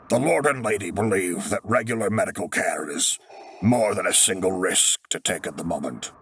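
lightly voiced senior medic; a hint of a crisp medic; brisk medic voice. Medic's voice, formal report.

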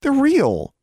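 high, loud, forceful insistence; emphatic insistence. A narrator's reading. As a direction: insist hard against a friend. Girl insisting against friend.

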